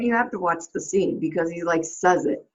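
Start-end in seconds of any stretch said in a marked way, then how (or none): none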